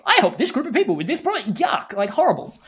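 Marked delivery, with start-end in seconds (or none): 0.0-1.5 high-pitched, hammed-up infomercial announcer; mock an over-excited TV product pitch. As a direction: parody infomercial host, pitched well up. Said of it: "it would sound like an infomercial".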